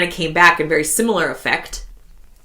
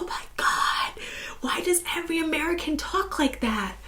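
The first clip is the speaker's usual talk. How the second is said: high, soft, whispery mock complaint; imitated exasperated colleagues; 11 semitones higher, 10 dB quieter than usual. Colleagues in Europe complaining about American speech, high hushed mock exasperation. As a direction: mimic them in a small high breath of dismay. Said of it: "And they'd be like".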